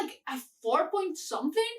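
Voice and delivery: ordinary delivery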